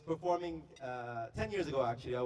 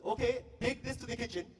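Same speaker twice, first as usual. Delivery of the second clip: higher-pitched Indian waiter voice; Indian accent; 7 semitones higher than usual. Retold or imitated. Indian waiter handing a singer the tray, Indian accent. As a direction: order it briskly in an Indian accent. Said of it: "this Indian waiter comes up to me".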